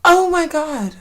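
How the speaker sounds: high, loud cry of laughter; breathless laughing exclamation. Real, not acted. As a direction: gasp it out mid-laugh. Overcome by laughter at herself.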